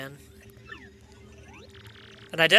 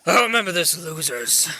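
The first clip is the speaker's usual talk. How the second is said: put-on sore-loser villain; strained villain voice; 2 semitones lower than usual. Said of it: "Doing that voice frickin' hurts".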